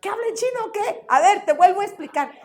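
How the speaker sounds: high, fast, impatient retort; mock-exasperated classroom voice; exasperated teacher. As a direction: snap back at a pupil who wasn't listening. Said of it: "Y yo".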